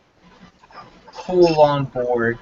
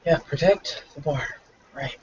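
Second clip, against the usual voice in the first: tired sigh; 6 dB quieter than usual. Acted. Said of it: "dreadfully tired".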